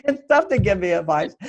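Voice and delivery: "It's tough to give me advice" said through a real laugh; chuckles at his own stubbornness while speaking; laughing at himself. Real, not acted. Husband admits to the audience he's hard to advise, laughing.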